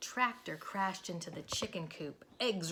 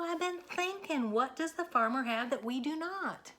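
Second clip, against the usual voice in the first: higher, excited idea; bursting with a clever idea; excited Moo; 6 semitones higher than usual. Acted. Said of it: "said Moo".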